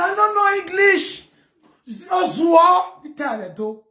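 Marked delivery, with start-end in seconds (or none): 0.0-1.3 high, slow, whiny fake sob; mock tearful complaint; mock crying. 1.9-3.2 slow, loud drawn-out shout; loud exasperated yell. Real, not acted. Outburst shout in rant.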